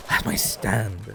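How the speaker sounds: slow, weighty That's my stand; slow dramatic reveal. Shown as a demonstration.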